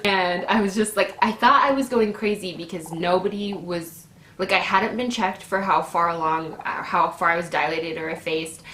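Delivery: ordinary talk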